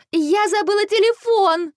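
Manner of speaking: very high, horrified cry; horrified exclamation. A narrator's reading. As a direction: cry out in a panic, pitch way up. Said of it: "в ужасе воскликнула Василиса". (восклицает в ужасе)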